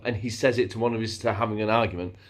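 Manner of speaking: ordinary delivery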